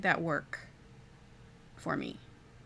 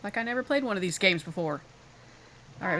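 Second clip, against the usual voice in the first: usual speaking voice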